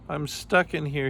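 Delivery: high, plaintive mock sob; sobbed. A narrator's reading. Trapped Kangaroo starts to cry, mildly sobbed. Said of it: "he sobbed".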